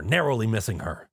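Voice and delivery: ordinary delivery, nothing marked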